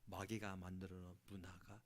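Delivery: ordinary talk, nothing marked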